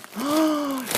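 sharp high gasp of delight; surprised gasp. Real, not acted. Opening gift, sudden surprise.

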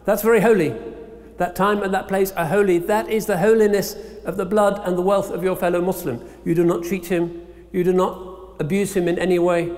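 normal talking voice

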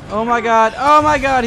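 loud, high-pitched panic; alarmed outcry. Real, not acted. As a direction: exclaim in mounting alarm. Zombie knocks something over.

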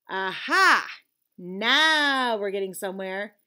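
loud triumphant aha. A narrator's reading. Conscience catching Leon out, loud and triumphant. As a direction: pounce on the confession.

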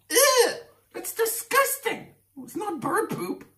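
put-on very high whiny little boy; child's voice. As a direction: squeak it like a scared kid. Boys voiced in a story.

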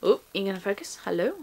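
ordinary talk

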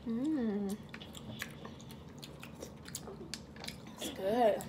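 chewing while murmuring; eating mid-sentence; mouth full of food. Real, not acted. Seen 0: Woman raises food to her mouth and appears to chew throughout.

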